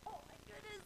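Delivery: high, very soft squeak; tiny strained voice. Retold or imitated. Dreamer pinned by piling snow, tiny strained voice. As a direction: barely let it out, pitched high.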